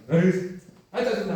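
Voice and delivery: high, loud exasperation; acted fit of annoyance; irritated outburst. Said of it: "화가 났어요". (짜증이 확 터진 듯 크게)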